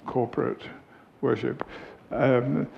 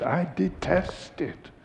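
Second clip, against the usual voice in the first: high, slow, emphatic distaste; imitating emphatic disapproval; retold loathing; 6 semitones higher, 4 dB quieter, slower than usual. Quoting her confessed strong dislike.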